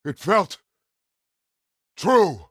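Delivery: high, loud forceful yell; battle shout. Acted. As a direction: shout the words out separately. Combat bark, shouted reply.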